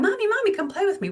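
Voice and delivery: put-on high, singsong child voice calling mommy; little boy begging. Three-year-old son begs mom to play, high singsong child voice. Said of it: "And he's going".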